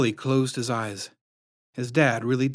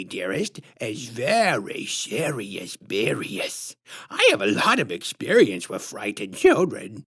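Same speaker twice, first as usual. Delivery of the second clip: high, slow sugary put-on voice, far above the narrator; sickly sweet menace, drawn out; syrupy sinister clown woman introducing herself; creepy clown lady; 5 semitones higher, slower than usual. A narrator's reading.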